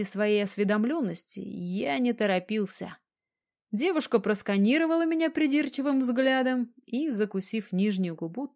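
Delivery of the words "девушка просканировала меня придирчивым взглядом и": ordinary delivery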